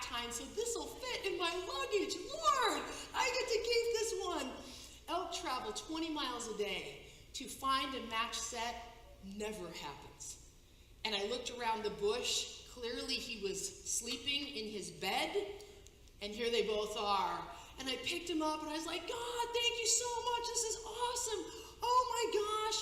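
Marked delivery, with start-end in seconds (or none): 2.3-4.5 high, gleeful cry to the Lord; thrilled, like a kid who got a gift; delighted. Retold or imitated. Overjoyed at keeping the antler. 19.1-22.8 high, laughing, near tears with joy; gushing gratitude, half laughing; overjoyed thanks. Retold or imitated. Thanking God for the antler pair.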